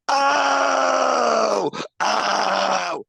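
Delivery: loud, high, hammed-up excited cry of "oh"; mock shout. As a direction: blurt it out like a giddy drunk. Sandman finds eight balls, exclaims.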